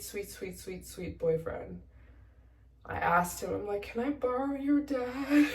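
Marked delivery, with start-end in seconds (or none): 3.8-5.5 higher, tearful laughing request; half laughing plea. Retold or imitated. Asking boyfriend to lend his dad, half laughing. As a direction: ask it high, on the edge of laughter.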